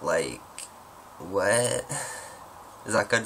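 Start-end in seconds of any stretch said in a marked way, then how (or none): 0.0-1.8 lightly attempted American pop-star accent; mock American celebrity talk. Shown as a demonstration. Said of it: "That's my [name] accent".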